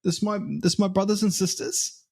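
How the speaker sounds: high, hammed-up Oi nah nah; mock-protective broad Antipodean mates voice; a sibling sticking up for family, pitched high; broad Aussie-Kiwi voice. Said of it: "be like".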